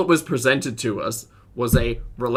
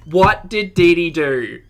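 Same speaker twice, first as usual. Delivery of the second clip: slow and louder, each word leaned on; emphatic disbelief; 4 semitones higher, 8 dB louder, slower than usual. Real, not acted. Host repeating the question to the stream, slow exasperated emphasis. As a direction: spell the question out slowly, exasperated.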